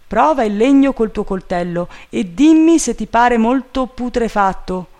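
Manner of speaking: high, loud instruction; calling orders. A narrator's reading. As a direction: project an order up to the treetop. Master calls orders up a tree.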